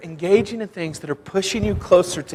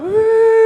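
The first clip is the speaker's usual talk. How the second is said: high playful whoop of joy; happy whoop; 4 dB louder than usual. Retold or imitated. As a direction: whoop as you fly across the water. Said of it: "just going".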